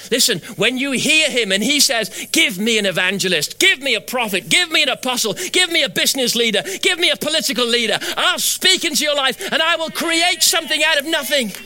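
climbing, insistent list building to a high peak; full-force preaching. Acted. God calling for prophets and leaders, building preacher's proclamation.

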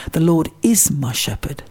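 "is" punched as the key word; verse with the weight on "is"; stress on is. Shown as a demonstration. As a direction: land firmly on the word "is". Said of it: "is emphasised".

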